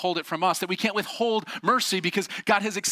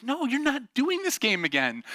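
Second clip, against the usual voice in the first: lightly imitated dad, raised pitch; dad's refusal; 8 semitones higher than usual. Dad forbidding the game to his kid, mild exasperated refusal. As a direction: put your foot down, pitched up.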